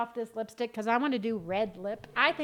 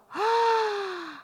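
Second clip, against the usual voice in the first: gasp; 8 semitones higher than usual. Real, not acted. Surprised by the sassy makeup box.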